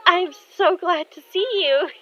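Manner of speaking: high, loud gushing; thrilled girl. Acted. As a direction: gush at finally seeing an idol. Thrilled girl greeting her idol.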